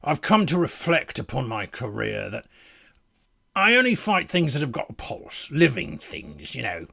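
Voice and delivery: lightly voiced battle-worn warrior musing on a career; deadpan in-character monologue; world-weary fighter. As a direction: reflect like a seasoned fighter after battle.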